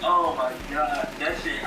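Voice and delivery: normal talking voice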